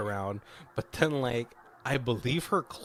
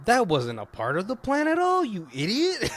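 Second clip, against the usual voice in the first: much higher, louder hammed-up outrage; mock furious scolding; 10 semitones higher, 5 dB louder than usual. Helen scolding Ernest for the killing, much higher hammed-up outrage. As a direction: blow up at him like a furious diva.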